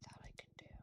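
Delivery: whispered under breath. Real, not acted. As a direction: say it in a hushed whisper. Whispered, then repeated aloud.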